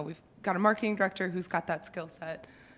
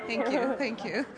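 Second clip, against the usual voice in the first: thanks through a laugh; 5 semitones higher than usual. Real, not acted. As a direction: giggle while thanking. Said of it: "You were killing it last night".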